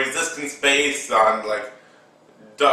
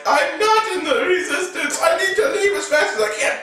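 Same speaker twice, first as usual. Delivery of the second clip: very high, fast and anxious; high rushed kid voice; high voiced Finn; faster than usual. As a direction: squeak it out in a hurry. Kid voices Finn wanting to leave.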